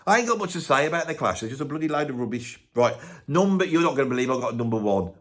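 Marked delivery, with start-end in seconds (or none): none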